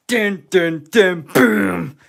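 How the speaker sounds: loud mock fanfare; dramatic sting. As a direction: sound out a drumroll and hit.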